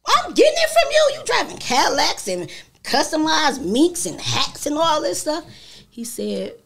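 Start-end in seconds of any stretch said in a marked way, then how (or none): 0.1-5.4 high-pitched mock protest; playful, squeaky-high comeback; retold indignant retort. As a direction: throw it back at dad, pitched way up. Acts her retort to her father.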